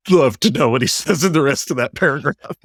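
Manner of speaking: real laugh breaking into the words; amused, laughing mid-sentence; amused aside. Real, not acted. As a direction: chuckle through the remark. Aside on clipped screenshot, laughs.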